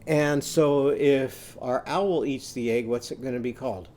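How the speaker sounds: ordinary talk, nothing marked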